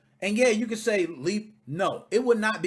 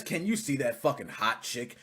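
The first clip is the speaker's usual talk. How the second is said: mocking imitation; 12 semitones lower, 9 dB quieter than usual. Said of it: "the same fucking asshole that's about to talk says".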